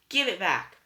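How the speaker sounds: high, loud snapped order; angry demand. A narrator's reading. Old sister demanding her tooth, angry and loud.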